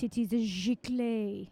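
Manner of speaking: slow mock French accent, "giclée" as "zhee-klay". Shown as a demonstration. Host puts on French accent to say sponsor name, slow and hammed-up. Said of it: "I should say it with my French accent".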